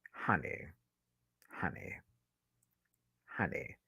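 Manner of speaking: low, fading near-whisper; very soft, low, spaced-out murmur; hushed honey. Pretend. Repeated honey fading in disbelief.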